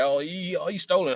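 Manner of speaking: a light mocking mimic of whiny believers; mocking whine. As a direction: poke fun at the Christians' complaint. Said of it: "they can't get over the fact".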